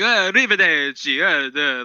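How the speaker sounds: high hammed-up Italian accent; mock Italian. Mocking the anchor's tough-guy talk in mock Italian. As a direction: toss off a cartoon Italian goodbye. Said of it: "like that kind of guy".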